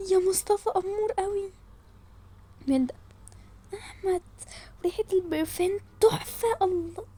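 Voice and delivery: lovestruck, hushed; swooning. Acted. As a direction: gush quietly over a crush. Girls swoon over boys.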